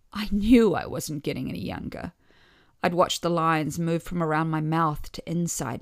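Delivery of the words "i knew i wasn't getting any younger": usual speaking voice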